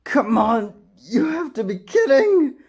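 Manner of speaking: high, loud panicked anime voice; dubbing an anime clip in a frantic high voice; anime character. Acted. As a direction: whine like a cartoon kid about to be crushed. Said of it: "So without further ado".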